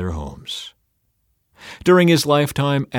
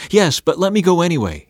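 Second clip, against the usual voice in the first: higher, insistent plea; begging; 7 semitones higher, 3 dB louder than usual. A narrator's reading. Ahimaaz presses Joab despite no reward, begging. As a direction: beg to be allowed along. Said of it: "he begged".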